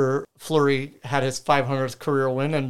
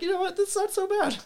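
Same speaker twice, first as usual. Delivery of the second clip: high mock voice. Team owners voiced, mock shrug.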